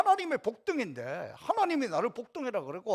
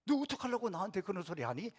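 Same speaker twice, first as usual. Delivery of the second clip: quiet inward retort; inner voice; 5 dB quieter than usual. Pretend. Pastor's silent reply to a critic, quiet and inward. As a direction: say it under your breath to yourself. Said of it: "속으로".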